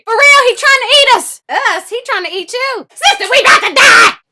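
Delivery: high loud terrified pleas; panicked shouting. Acted. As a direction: scream in terror. Pigs panicking at door.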